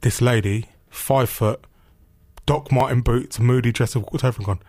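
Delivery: usual speaking voice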